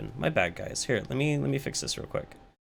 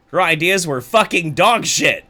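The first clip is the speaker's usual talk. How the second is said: louder, stress on dog; loud emphatic; 7 semitones higher, 9 dB louder than usual. Real, not acted. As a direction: punch out "fucking dog shit" hard. Escalating insult of ideas.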